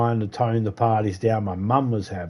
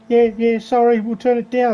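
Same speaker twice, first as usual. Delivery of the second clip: imitated sheepish teenager; 10 semitones higher than usual. Teenager apologising to angry neighbour, higher put-on voice. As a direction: play the kid mumbling a quick sorry.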